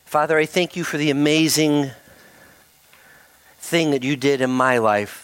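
earnest spoken prayer to God, slowed. Real, not acted. Preacher leading the standing church in prayer, earnest. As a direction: confess before the Father in earnest.

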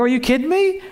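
high, incredulous question; can't believe what you're hearing; mild mock disbelief; astonished. Preacher astonished by lady.

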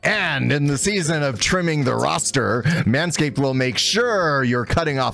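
hammed-up sportscaster ad read; gruff announcer. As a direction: sell it like play-by-play commentary. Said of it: "you're the gruff announcer".

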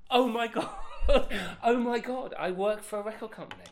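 high, loud, laughing oh my God; giddy squeal of a kid who just got the dream job; overjoyed disbelief. Retold or imitated. Reliving the moment he got his first record-company job, laughing and thrilled. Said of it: "like, twenty-one going".

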